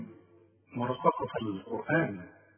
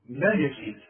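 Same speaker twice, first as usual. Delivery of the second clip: ordinary talk, nothing marked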